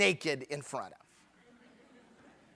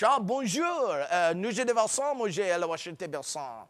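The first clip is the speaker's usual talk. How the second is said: mock French; 2 semitones lower, 5 dB quieter, faster than usual. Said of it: "It's like speaking French".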